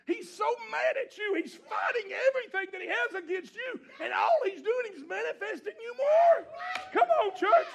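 rising from firm preaching to a high, loud cry; climbing, fiery preacher's call; building preaching shout. Real, not acted.

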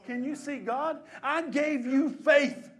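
loud, high, impassioned pleading sermon; full-force preaching as God. Acted. God to believers who will not accept his gifts, shouted pleading. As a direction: cry out God's appeal, stretching the key words.

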